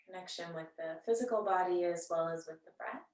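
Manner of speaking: soft, unhurried yoga-teacher voice with long pauses; guided meditation. Real, not acted. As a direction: gently guide them into settling and noticing the breath. Leads seated breathing meditation.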